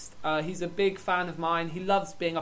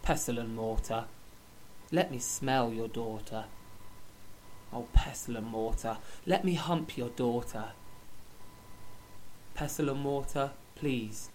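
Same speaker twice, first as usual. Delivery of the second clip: solemn poem recital; 7 semitones lower, 6 dB quieter than usual. Acted. Man reading a poem for his first love, solemn and low. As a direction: recite it gravely, low and measured.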